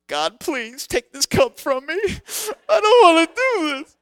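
high, loud hammed-up wailing prayer; mock anguished plea. Petrified youth volunteer begs God behind the door, hammed-up wail. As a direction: beg God to spare you, with an exaggerated wail.

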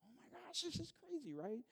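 high, breathy-soft gasp of amazement; imagined disciples' hushed wonder; amazed disbelief. Retold or imitated. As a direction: whisper it like you can't believe your eyes. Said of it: "you could imagine that they're talking to each other like".

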